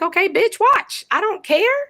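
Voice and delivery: high, defiant mock retort to the watchers; sassy retort. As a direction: toss it off with attitude, high. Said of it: "And it's like".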